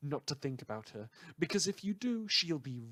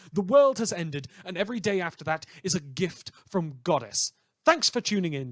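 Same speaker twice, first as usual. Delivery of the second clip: brash radio host; 2 semitones higher, 6 dB louder than usual. Acted. Radio host to his listeners, loud brash showman patter. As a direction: rattle off the tabloid news like a hyped DJ.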